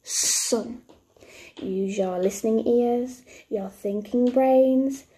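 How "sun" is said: "Sun" with a long drawn-out s; nice and slowly. Shown as a demonstration. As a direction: stretch the start of "Sun" for learners. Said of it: "nice and slowly".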